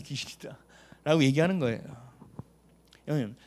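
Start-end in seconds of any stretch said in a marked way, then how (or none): 0.0-0.6 spooky whispered word; whispered eerie hush. Pretend. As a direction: breathe it like a ghost story.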